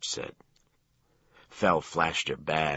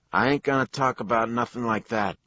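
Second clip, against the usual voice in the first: put-on higher, louder thick Jersey accent; 8 semitones higher, 7 dB louder than usual. A narrator's reading. Hospital worker refusing detectives, thick Jersey accent. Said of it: "his Jersey accent as thick as mayonnaise".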